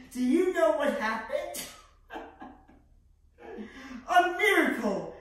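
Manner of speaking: high, loud, laughing disbelief; laugh, then crow it out high; gleeful laughing. Acted. Salieri revealing his miracle, gleefully laughing.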